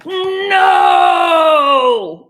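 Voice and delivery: long, high, loud "no"; drawn-out howl sliding down in pitch; mad cat. Acted. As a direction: shout it furiously and stretch it out. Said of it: "that cat's so mad right now".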